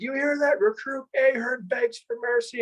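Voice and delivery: very high hollering imitation of an instructor; mock public announcement. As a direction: crow it out to the whole platoon. Instructor announces it to whole platoon.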